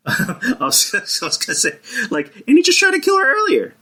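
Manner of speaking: laughing through the words, pitch far up; can't stop laughing while he says it. Real, not acted. Laughing to his co-host about the kiss.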